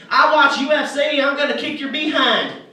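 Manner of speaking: hammed-up shouted threat; ready to fight. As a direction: bellow it like a hothead spoiling for a fight. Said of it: "he's ready to fight".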